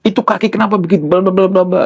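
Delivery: high, rapid mock scolding; kenceng orang Timur. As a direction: imitate an eastern Indonesian telling someone off. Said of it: "kenceng, ya, suaranya". (menirukan omelan kenceng orang Timur)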